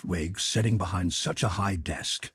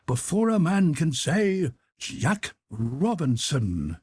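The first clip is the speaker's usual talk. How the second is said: put-on a rich, fat, jovial old master calling out; jovial Fezziwig voice; 8 semitones higher, slower than usual. A narrator's reading. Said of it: "cried old Fezziwig".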